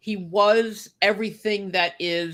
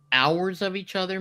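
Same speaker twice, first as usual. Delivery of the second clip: ordinary talk, nothing marked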